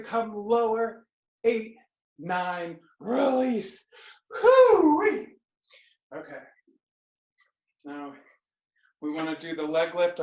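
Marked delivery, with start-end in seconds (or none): 4.4-5.3 high whoop. Real, not acted. Exhale after the exercise set.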